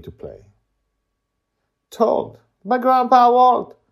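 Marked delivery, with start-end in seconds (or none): 1.9-3.8 loud, high, slow title read; announcer voice. Acted. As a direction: announce it grandly.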